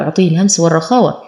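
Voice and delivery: ordinary talk, nothing marked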